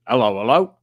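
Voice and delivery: hammed-up copper greeting; a hint of an old-school policeman; old-time policeman. Shown as a demonstration. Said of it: "Like that".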